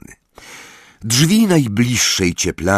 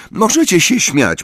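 put-on higher, louder defiant girl; raised voice; 12 semitones higher, 5 dB louder than usual. A narrator's reading. Luna to the girls laughing at her, raised voice. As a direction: raise your voice at the gigglers. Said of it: "podnosząc głos".

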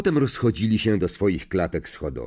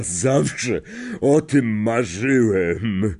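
slow, emotional gamekeeper voice; Hagrid; 3 semitones higher than usual. A narrator's reading. Gamekeeper marvels at his dream job, slow overcome character voice. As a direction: draw it out, overwhelmed and moved. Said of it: "Ogarnęło go takie wzruszenie".

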